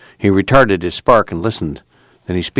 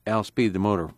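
ordinary delivery

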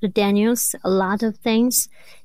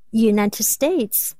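normal talking voice

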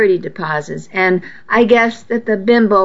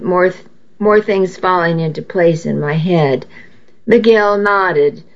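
usual speaking voice

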